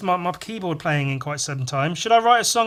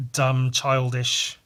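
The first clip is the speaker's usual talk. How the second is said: ordinary talk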